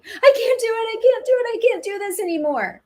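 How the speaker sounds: high, loud, panicked cry of I can't; panicked laboring mother. Retold or imitated. Mother in transition telling her partner, high panicked wail. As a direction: wail it like she's at her limit. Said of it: "she says something like".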